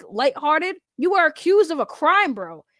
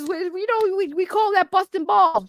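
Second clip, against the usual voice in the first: higher, whiny parody of their excuse; mocking imitation; 6 semitones higher than usual. Mocking the podcast guests' excuse to the viewers, in a high whiny parody. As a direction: sneer it back like their lame defense.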